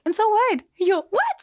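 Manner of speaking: high, loud incredulous retort; disbelieving recruiter; incredulous so what. Retold or imitated. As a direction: scoff at the worry. Recruiter dismissing her worry.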